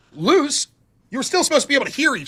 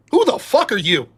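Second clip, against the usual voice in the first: ordinary talk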